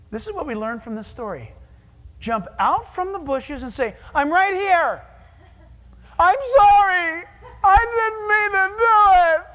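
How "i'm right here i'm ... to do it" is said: pleading confession. Acted. Sinner jumps from the bushes to confess to God, loud pleading. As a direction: blurt out the sorry from the bushes.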